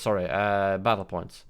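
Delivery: normal talking voice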